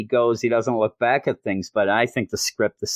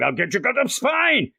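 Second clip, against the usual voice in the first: high, far-off hammed-up threat; cartoonish monster threat, higher; mock angry bat; 11 semitones higher than usual. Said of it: "He ends up yelling".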